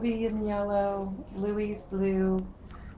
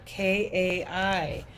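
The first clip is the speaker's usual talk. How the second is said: spelled slowly; slower than usual. Shown as a demonstration. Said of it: "it's not Kia, it's Kai".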